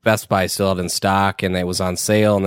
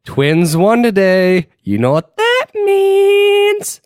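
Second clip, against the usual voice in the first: slow, louder, climbing very high; teasing announcement; 13 semitones higher, 5 dB louder, much slower than usual. Retold or imitated. Hinting at half-off pizza to Carrie, slow rising tease. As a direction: draw it out slowly, a sly high tease. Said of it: "I look over at Carrie and I go".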